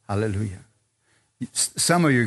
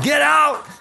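high, loud retold shout; fired-up command; 12 semitones higher, 5 dB louder than usual. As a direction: shout it as if throwing out an intruder. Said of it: "I got all fired up".

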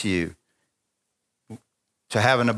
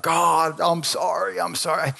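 mock tearful plea, raised pitch; higher, pleading retold cry; retold bawling; 5 semitones higher, slower than usual. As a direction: imitate a pleading, sobbed apology. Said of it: "bawling my eyes out".

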